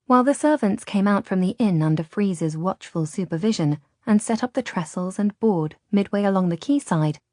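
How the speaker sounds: ordinary delivery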